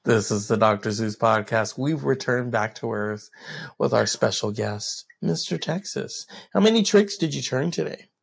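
low far podcast host voice; deep announcer voice. Pretend.

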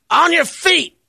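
high loud gotcha shout; gleeful punchline. Retold or imitated. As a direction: crow the punchline. Punchline of homeless guy.

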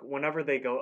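usual speaking voice

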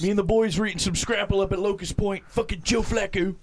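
high-pitched, hammed-up Baltimore accent. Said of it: "that's what you have with Baltimore".

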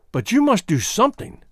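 high, insistent protest; urgent cry. A narrator's reading. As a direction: cry it out, pitched well up. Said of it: "she cried".

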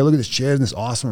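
mock-thrilled show-and-tell voice; excited boss. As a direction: show off the chair like a proud kid. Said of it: "he's so excited to tell me about this chair".

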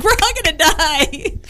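high, drawn-out mock wail; mock panic. Guest acting out daily mood swing, mock panic. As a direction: swing up into playful doom. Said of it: "every day I go up and down".

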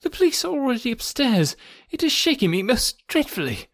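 high, trembling doctor's voice; a frightened physician breaking bad news; shaken doctor.

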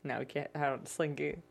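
ordinary talk, nothing marked

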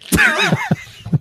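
high, loud squeal of laughter; a shrieking real laugh; cracking up, high and loud; burst of laughter. Real, not acted. Friend texted, laughs loudly.

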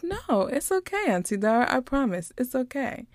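high little-girl imitation, softening at the end; sweet reassuring child. Niece reassuring her apologetic aunt, high sweet child imitation. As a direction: imitate a child sweetly insisting it's fine. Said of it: "she's smiling so sweetly".

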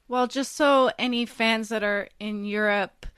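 ordinary delivery

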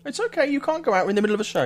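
high coaxing voice to a pet. Real, not acted. Host coaxing his dog to stay, high baby talk. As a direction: soothe the dog in a high sing-song. Talking to dog during show.

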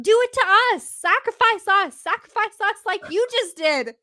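hammed-up high shout; shouting eagerly. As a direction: shriek it like thrilled fans. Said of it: "One and Seven Death shout out".

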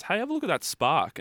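high excited call. Retold or imitated. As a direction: point it out to a mate, high and excited. Mate calling out.

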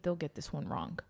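whispering. Real, not acted. Whispered aside to teammate.

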